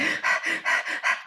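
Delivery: quick audible breaths in a row; short fast puffs of breath. Shown as a demonstration. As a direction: demonstrate rapid breathing. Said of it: "a little bit of a faster breath".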